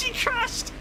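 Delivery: high, squealing laugh through the words; crying with laughter, barely getting words out; hysterical laughter. Real, not acted. Friend crashes, streamer loses it.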